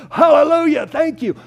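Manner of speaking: hammed-up joyful relief; mock jubilant relief. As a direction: burst out like a rescued reader. Mock reader's relief at chapter forty.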